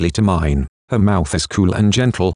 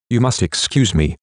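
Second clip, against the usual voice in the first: ordinary delivery, nothing marked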